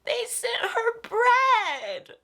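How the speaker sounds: very slow, high, breaking into a laugh near tears; choked up but laughing at herself; teary laugh-cry. Real, not acted. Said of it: "I'm gonna cry thinking about it".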